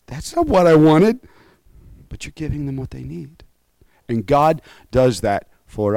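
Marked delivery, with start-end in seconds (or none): 0.1-1.2 put-on child's complaint. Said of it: "and it's like".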